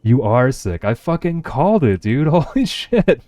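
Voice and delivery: real gleeful glee at being right; triumphant excitement. Real, not acted. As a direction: crow over guessing it right, laughing. Guessed viewer is sick.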